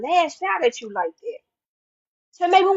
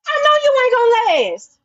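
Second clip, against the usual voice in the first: defiant taunt; 7 semitones higher, 3 dB louder than usual. Real, not acted. Taunting her rivals.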